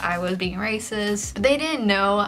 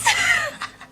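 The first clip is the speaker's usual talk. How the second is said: high laugh; 3 dB louder than usual. Real, not acted. Laughs at the memory.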